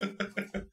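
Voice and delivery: quiet, high little chuckle; soft chuckle. Acted. Game master as the character answering a player, soft chuckle. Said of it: "She just chuckles".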